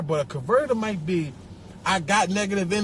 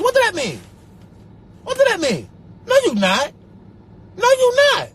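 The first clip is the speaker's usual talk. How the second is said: high, loud disbelieving outburst; incredulous yell; 12 semitones higher, 5 dB louder than usual. Acted. Ranting at people storing happiness, high loud disbelief. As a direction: fire it back in disbelief.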